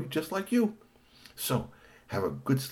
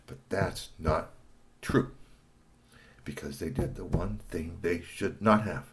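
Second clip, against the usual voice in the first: usual speaking voice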